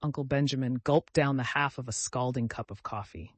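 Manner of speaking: usual speaking voice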